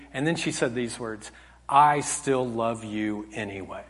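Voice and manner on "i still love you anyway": slow, low, soft reassurance; tender. Retold or imitated. Daughter forgiving her dad, retold tenderly. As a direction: say it gently and let it land slowly.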